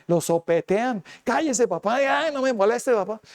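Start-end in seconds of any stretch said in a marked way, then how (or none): 1.2-3.2 high, snappy scolding of a parent; mock rude child yelling. Preacher imitating a child yelling at his father, mock rude. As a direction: sneer it like a spoiled grown child. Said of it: "le gritan".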